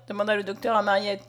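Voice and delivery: ordinary delivery, nothing marked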